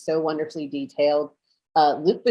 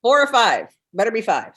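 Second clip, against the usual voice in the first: glitchy robotic call audio; breaking up like a robot; 5 semitones higher, 3 dB louder than usual. Real, not acted. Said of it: "You sound like a robot".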